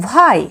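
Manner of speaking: ভাই said as "vai" in one syllable; teacher modelling ভাই; pronunciation demo. Shown as a demonstration. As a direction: say ভাই, the letters V Y blended. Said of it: "VY আমরা উচ্চারণ করব".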